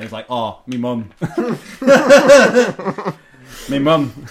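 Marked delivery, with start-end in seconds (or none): 0.3-1.1 put-on northern accent. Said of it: "In a real northern accent". 3.7-4.3 put-on northern accent. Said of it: "In a real northern accent".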